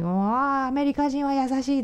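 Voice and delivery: high gushing delighted auntie; excited auntie voice. Retold or imitated. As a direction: gush like a charmed Japanese auntie. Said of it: "とか言って、おばさんに".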